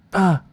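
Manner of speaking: sudden high loud "Ah!"; a comic sound effect for jacking off; mock climax cry. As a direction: blurt a yelp of release. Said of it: "I'd be like".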